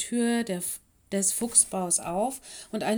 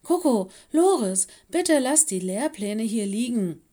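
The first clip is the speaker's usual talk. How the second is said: loud, high call of both names, then firm order; sharp reprimand, louder at the start; stern; 3 semitones higher, 7 dB louder than usual. A narrator's reading. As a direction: scold like a strict headmistress. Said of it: "sagte sie streng".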